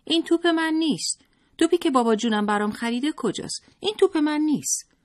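put-on high, loud whiny child wail; high, loud tearful boy demanding his own ball; crying child tantrum. A narrator's reading. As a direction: throw a tantrum like a little boy. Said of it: "قشقرق راه انداخت و گریه سر داد".

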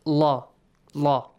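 "la" with a full, heavy, dark l and a; heavy lam. Shown as a demonstration. Teacher showing students the heavy sound, heavy lam. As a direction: say "la" heavily, like "law". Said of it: "pronouncing it with a heavy sound".

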